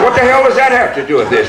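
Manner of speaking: high, half-laughing disbelief; incredulous retort, laughing. Retold or imitated. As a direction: toss it back, amused and outraged.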